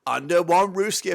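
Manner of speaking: high, loud hype-man intro to the channel; hyped YouTube intro. Acted. Host welcoming viewers to the channel, high hyped announcer voice. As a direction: pump up new viewers like an announcer.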